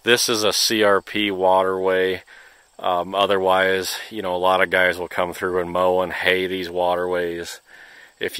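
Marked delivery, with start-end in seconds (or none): none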